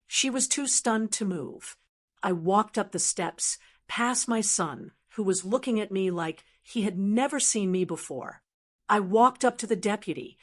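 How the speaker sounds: ordinary delivery, nothing marked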